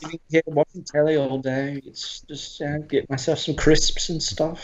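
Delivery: casual British telly-watcher voice; put-on British accent. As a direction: lightly imitate a British accent. Continuing British accent demo.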